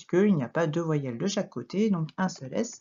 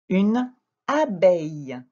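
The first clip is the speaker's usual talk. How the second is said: "Une abeille" slow and louder, word by word; slow dictation; 6 dB louder, slower than usual. Shown as a demonstration. Teacher dictates the word slowly.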